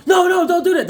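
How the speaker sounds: high, loud panicked no; alarmed shout. Retold or imitated. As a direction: yell a warning at the kid. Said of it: "instead of them going".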